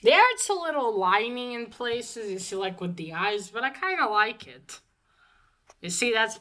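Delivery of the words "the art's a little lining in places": ordinary delivery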